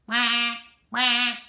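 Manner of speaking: loud, high quacking; duck quack. Acted. The ducks answer Mister Gregg, loud quacking. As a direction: quack back at them like a duck. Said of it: "looked up and quacked".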